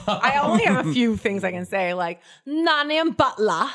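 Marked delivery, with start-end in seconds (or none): 2.5-3.7 slow, higher hammed-up British accent; drawn-out mock British through laughter. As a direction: put on a silly British accent. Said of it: "I'm really good at British".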